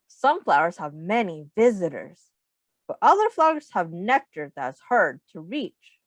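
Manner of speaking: ordinary talk, nothing marked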